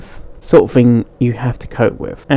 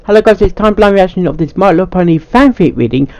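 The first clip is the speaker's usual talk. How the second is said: higher, brighter YouTube greeting; cheery channel intro; upbeat intro voice; 5 semitones higher, faster than usual. Acted. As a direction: sell the opening like a host. Intro take.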